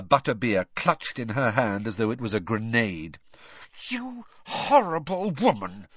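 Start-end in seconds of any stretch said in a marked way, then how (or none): 3.9-5.9 higher, tightly held anger; through gritted teeth. A narrator's reading. Hermione rounds on the reporter, through gritted teeth. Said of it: "she said through gritted teeth".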